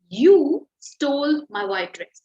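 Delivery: ordinary delivery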